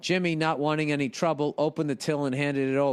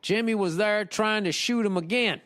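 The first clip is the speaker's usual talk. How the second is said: higher-pitched imitation Virginia accent; thick Virginia accent; 6 semitones higher than usual. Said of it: "in his thick Virginia accent".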